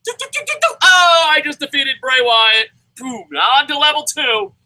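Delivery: high, loud gamer cheer with a laugh; mock victory. Gamer mocking the easy boss, high loud cheer. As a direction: celebrate beating the boss. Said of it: "he's like".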